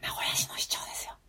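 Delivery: hushed unvoiced whisper, barely audible. Real, not acted. Telling the cohost about an awkward moment, whispered. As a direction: breathe it out so the host can hardly hear. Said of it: "ウィスパーすぎ".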